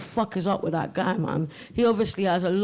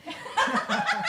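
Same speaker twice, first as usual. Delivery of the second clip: high squealing laugh; burst of laughter; 3 dB quieter than usual. Real, not acted.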